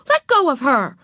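high, sharp protective order; angry. A narrator's reading. Beezus defends Ramona from Wayne, angrily. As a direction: come to a little sister's defence. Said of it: "said Beezus angrily".

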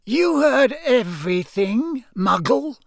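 high, loud, slow cold villain. A narrator's reading. Villain questioning the caught eavesdropper, high and cold. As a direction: question him coldly, high and drawn out. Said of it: "said the cold voice".

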